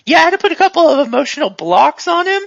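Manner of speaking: lightly voiced handler giving a heads-up; Sigrid. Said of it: "the voice of Sigrid".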